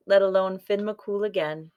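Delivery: plain reading voice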